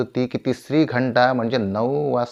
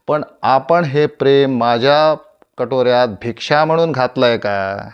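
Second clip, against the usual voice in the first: loud, raised defiant oratory; proud challenge; 3 semitones higher, 7 dB louder than usual. Acted. Actor refusing humility before his audience, loud and defiant. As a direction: challenge the house, voice pushed up.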